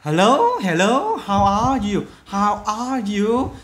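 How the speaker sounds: loud, high, slow model greeting; upbeat greeting demo. Shown as a demonstration. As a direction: greet warmly and stretch it out. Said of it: "Ừm". (chào hỏi mẫu to, chậm, giọng cao)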